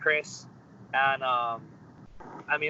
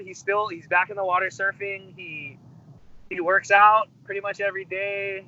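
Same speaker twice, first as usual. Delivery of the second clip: ordinary talk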